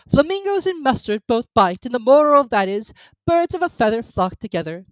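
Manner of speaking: put-on high, loud fussy old duchess. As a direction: prattle like a silly duchess. Duchess character voice.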